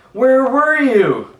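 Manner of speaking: high, loud, slow eager question; excited kids. A narrator's reading. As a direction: call it out like thrilled kids.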